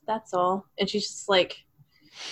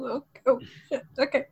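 ordinary delivery

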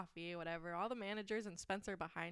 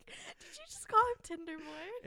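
higher, louder, slow stunned question; shocked disbelief; 11 semitones higher, 12 dB louder, slower than usual. Retold or imitated. Coworker reacting to manager's nickname, shocked. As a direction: wake up stunned and ask it.

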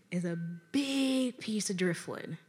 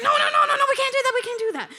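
very high, loud mock panic; frantic refusal; 7 dB louder, much faster than usual. Boyfriend refusing to break the window, frantic refusal. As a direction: rattle off the no's in alarm.

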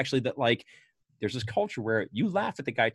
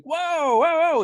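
high, loud teasing whoa; mock whoa; 8 dB louder, slower than usual. The crew ribbing Spider after his comeback, high and loud. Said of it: "And like".

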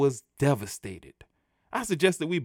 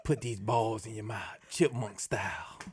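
mock dominant voice; 2 semitones lower, 7 dB quieter, slower than usual.

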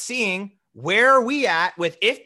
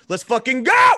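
excited shout; 4 semitones higher, 8 dB louder than usual. Acted. Hyping up the pilot's stunt.

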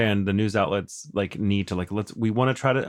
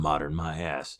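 scornful; 4 semitones lower, 6 dB quieter, slower than usual. Real, not acted. Scornful retort to the news.

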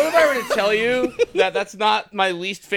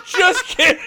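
high, louder teasing jab; mock just kidding; 9 semitones higher, 4 dB louder than usual. Show mocking its audience, high and teasing. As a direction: toss it off like a smug prank reveal. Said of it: "and then they go".

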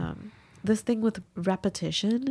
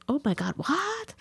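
high, softer mock disbelief; imitated outrage; 6 semitones higher, 4 dB quieter than usual. Imagined neighbor hearing the price, mock outraged. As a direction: play the scandalized friend, pitched up. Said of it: "I thought she would be outraged".

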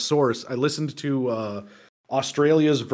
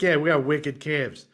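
put-on Boston accent; 2 semitones lower than usual. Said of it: "he's from Boston".

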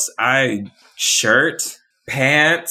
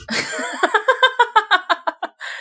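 bursts into a high laugh. Real, not acted. Laughing at her own impression.

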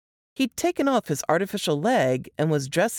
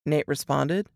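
usual speaking voice

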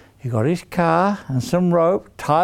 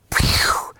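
whooshing sound effect. Acted. Tree snapping back.

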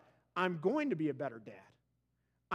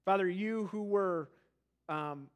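hushed, unhurried prayer voice; closing prayer. Acted. Pastor leading the church in prayer, hushed and reverent.

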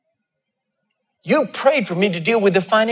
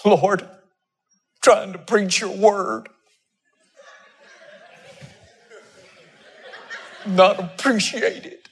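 whiny self-pity; 4 dB quieter, slower than usual. Retold or imitated. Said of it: "Self-pity".

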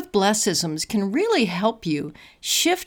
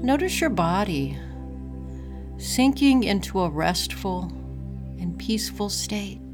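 calm, unhurried breathing guide; guided meditation; 2 semitones lower, 7 dB quieter than usual. Acted.

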